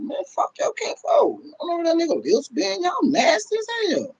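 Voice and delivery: ranting to viewers about sharing with kinfolk, high and grossed out; high disgusted rant. Real, not acted.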